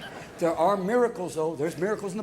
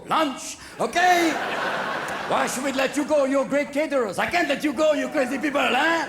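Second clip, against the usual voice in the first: put-on raised, comic French accent; 3 semitones higher than usual. French refusing to free their caterers, comic French accent. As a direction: play an indignant Frenchman with a thick accent. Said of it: "His French accent was killing me".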